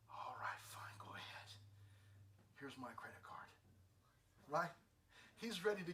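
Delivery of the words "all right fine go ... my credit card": hushed, defeated father; whispered dad giving in. Retold or imitated. Dad whispering as he gives in to his daughter. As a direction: cave in softly, in a whisper.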